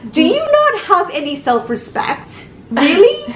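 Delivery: exasperated rhetorical question; incredulous scoff. Retold or imitated. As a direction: scoff at her with disbelief. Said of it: "I'm thinking".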